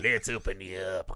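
low, lightly voiced sleazy repairman; a hint of a creepy guy with a drill; sleazy creep. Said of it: "yellowed wife beater jerker offer guy".

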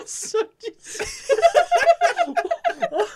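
words squeezed out through laughter; real laughter. Real, not acted.

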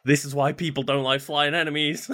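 exasperated complaint. Retold or imitated. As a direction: grumble it as if fed up mid-fight. Acting own frustration at dodging enemies.

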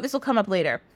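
ordinary delivery

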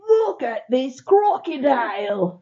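loud, drawn-out Australian exclaiming; hammed-up Australian croc hunter; Australian accent. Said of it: "That's Australian".

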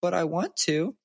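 put-on high whining little girl; whiny toddler. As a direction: plead like a toddler denied the park. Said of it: "She goes".

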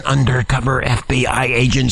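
dropped into a very low pitch; repeated for emphasis. Shown as a demonstration. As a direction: intone it deep and deliberate. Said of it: "Let me say it again".

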